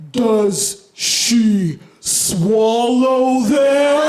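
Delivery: drawn out, very slow; slow-motion horror replay. Retold or imitated. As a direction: stretch every word like a slowed-down tape. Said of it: "I heard it in slow motion".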